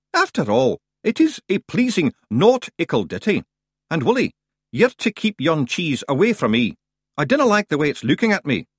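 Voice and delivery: a wee Scottish chief fretting about cheese; Scottish Feegle leader. Acted. Rob Anybody on the log.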